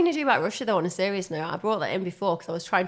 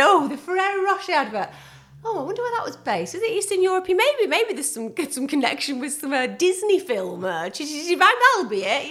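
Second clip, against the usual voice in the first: mocking imitation; 8 semitones higher, 4 dB louder than usual. Mocking partner's wondering.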